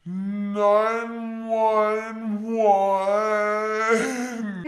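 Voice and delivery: very slow, dragged-out slurred words; slurred plea. Retold or imitated. Daughter pleading with her mom to call for help, very slow and slurred. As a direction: force the words out though your mouth won't work. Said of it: "and I go".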